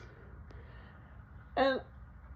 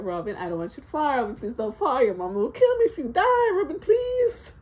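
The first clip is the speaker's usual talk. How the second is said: building to high, loud begging; panicked pleading; 5 semitones higher, 4 dB louder than usual. Retold or imitated. Scared dad begging kid off the car. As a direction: plead harder and higher each line.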